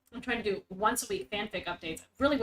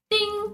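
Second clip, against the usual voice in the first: mock counter ding; 11 semitones higher, 6 dB louder than usual. Mock sin counter sound.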